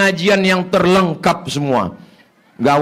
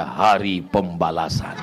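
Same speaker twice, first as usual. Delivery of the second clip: grim mock wife; 10 semitones lower, 6 dB quieter than usual. Wife's grim reply to husband.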